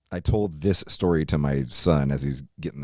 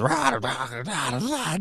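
mock drunk babble, pitched up; drunk babbling; 9 semitones higher, slower than usual. Drunk friend babbling up front, mock slurred. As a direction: imitate a hammered guy rambling. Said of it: "just fucking babbling on".

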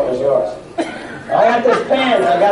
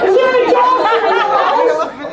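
high alarmed call; 13 semitones higher than usual. Pretend. Repeating the alarm line.

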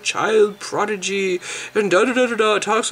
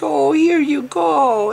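mock helpful website; 5 semitones higher, 4 dB louder, slower than usual. Website voiced offering proof.

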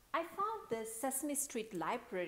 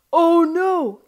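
loud, higher cry of dismay; alarmed cry; 9 semitones higher, 22 dB louder than usual. A narrator's reading. Boy sees his ball fly astray, alarmed cry. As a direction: cry out as things go wrong. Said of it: "he cried".

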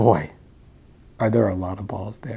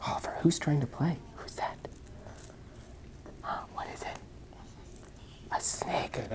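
whisper; 3 semitones higher, 14 dB quieter than usual. Real, not acted. Mother whispering to her son about the snake. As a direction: whisper it like a secret.

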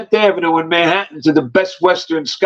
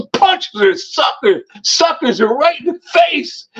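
usual speaking voice

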